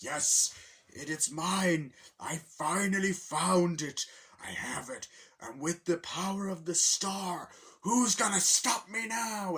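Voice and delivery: slow, high, loud triumphant Bowser; gloating villain. Retold or imitated. Bowser holding the Power Star, slow loud gloating. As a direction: savor each word like a tyrant who just won.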